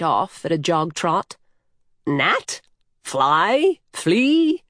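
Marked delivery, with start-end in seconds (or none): 2.1-4.6 playful one-word guesses, each climbing higher; teasing, stepwise rising guesses. A narrator's reading. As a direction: tease with rising questions, pausing between them. Horse teasingly guesses her name.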